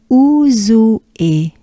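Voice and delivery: ouzoue in separate parts, "ou-zou-é"; unlinked syllables. Shown as a demonstration. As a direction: ouzoue taken slowly, not linked yet. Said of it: "We're gonna link it a bit".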